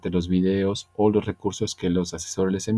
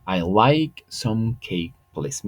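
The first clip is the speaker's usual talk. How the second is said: slow English demo; much slower than usual. Shown as a demonstration.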